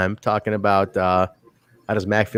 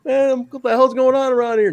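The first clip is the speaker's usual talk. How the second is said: mock yell; 12 semitones higher than usual. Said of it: "yelling from afar".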